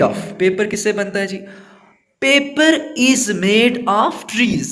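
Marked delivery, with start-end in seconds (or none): 2.2-4.7 very slow, higher, each word spaced out for copying; slow dictation. Shown as a demonstration. Teacher dictating the answer to students, very slow. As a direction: spell it out word by word for the class.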